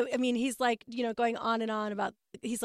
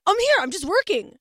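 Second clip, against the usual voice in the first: irritated husband; 9 semitones higher, 9 dB louder than usual. Retold or imitated. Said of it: "he's like".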